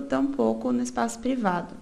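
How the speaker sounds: usual speaking voice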